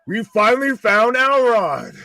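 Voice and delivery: mock-triumphant discovery; excited. Real, not acted. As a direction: announce the find like a big reveal.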